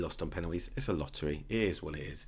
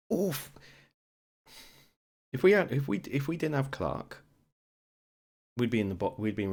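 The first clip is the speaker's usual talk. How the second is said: frustrated rant; 7 semitones higher, 5 dB louder than usual. Real, not acted. Ranting about useless strikers.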